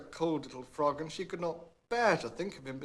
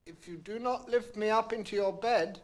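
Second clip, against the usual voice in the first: higher lightly voiced frog; a sly little threat; frog threatening; 8 semitones higher, 4 dB louder than usual. A narrator's reading. Frog warning the princess, lightly voiced threat. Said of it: "went on the frog".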